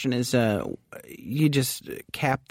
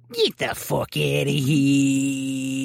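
slow, drawn-out New York tough guy; New York accent, dragged out and gruff; much slower than usual. Retold or imitated. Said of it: "they're gonna say it in that New York accent".

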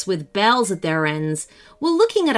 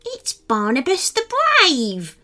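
higher, slow, proud correction; indignant gnome; 6 semitones higher than usual. A narrator's reading. Younger gnome correcting his nickname, indignant. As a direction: draw out the title proudly.

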